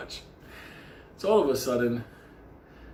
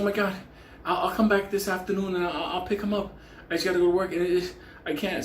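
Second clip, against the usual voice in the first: higher, stumbling imitation of a rushed neighbor; retold hurried neighbor; 7 semitones higher, faster than usual. Neighbor leaves the cat with Benny, higher flustered imitation. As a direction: mimic her pitched-up, hurried excuses.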